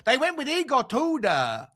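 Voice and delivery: put-on comic Italian accent building to a shout; exaggerated Italian-English, big finish on the punchline. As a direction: rant as a hammed-up Italian manager. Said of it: "doing an Italian accent again".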